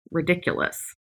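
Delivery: ordinary talk, nothing marked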